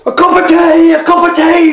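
put-on high, loud calling old woman; high loud mock call of the old mother. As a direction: shriek it like a wild old woman flying past. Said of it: "she called".

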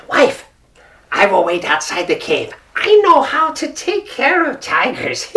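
high, scheming jackal voice; a crafty jackal pleased with his plan; sly jackal. Acted. Jackal telling his wife his plan, sly and high.

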